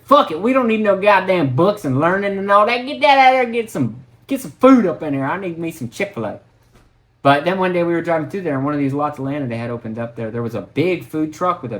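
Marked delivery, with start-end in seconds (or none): 0.0-6.4 mock townsfolk. Town folk ditching school for fast food, high and loud. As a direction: ham it up high and loud like a careless local. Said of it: "They figured".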